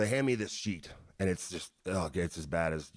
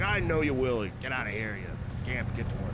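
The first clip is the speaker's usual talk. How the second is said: Vince impression; 4 semitones higher than usual. Vince voiced in retold story.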